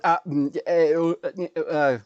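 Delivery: put-on loud babbling little girl; mock toddler babble. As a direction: babble like a toddler demanding juice. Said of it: "then she was going".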